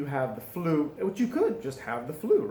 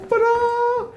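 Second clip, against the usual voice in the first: high, loud, held ta-da fanfare; drawn-out mock fanfare; playful ta-da; 8 dB louder than usual. Real, not acted. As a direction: sing out a long triumphant ta-da. Triumphant fanfare after turning on lights.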